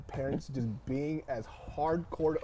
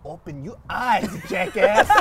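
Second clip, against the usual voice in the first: slow, louder deadpan insult; imitating dad's put-down; 4 semitones higher, 8 dB louder, slower than usual. Dad to the bleeding son, a slow louder mock put-down. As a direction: toss off the insult coldly. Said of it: "This man looks dead in my eyes and says".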